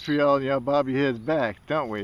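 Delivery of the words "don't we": normal talking voice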